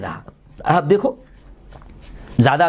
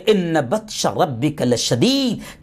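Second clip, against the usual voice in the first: slow Quranic recitation; 3 semitones lower, much slower than usual. Acted. Preacher to his listeners, slow solemn recitation. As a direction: recite the verse slowly and reverently.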